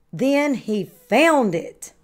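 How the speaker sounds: usual speaking voice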